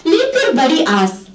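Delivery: ordinary talk, nothing marked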